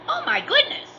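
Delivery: very high, loud exclamation; startled cry. A narrator's reading. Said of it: "cried Goldilocks".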